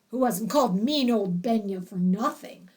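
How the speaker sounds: ordinary delivery, nothing marked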